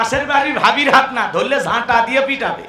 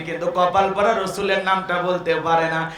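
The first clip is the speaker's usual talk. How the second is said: normal talking voice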